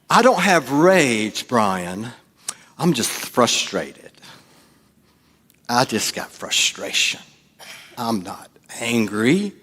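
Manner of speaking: slow, restrained denial dropping to a hush; disguised anger. Acted. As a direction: deny it through tightly held-back anger. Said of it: "That's how we disguise anger".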